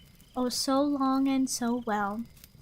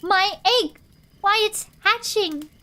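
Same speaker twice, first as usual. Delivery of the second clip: shouted=25; 6 semitones higher, 5 dB louder than usual. A narrator's reading. Horton shouting as egg hatches.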